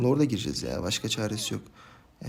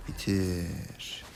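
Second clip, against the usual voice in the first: drawn-out urging; 6 semitones lower, 7 dB quieter than usual. Real, not acted. Same urging repeated, drawn out.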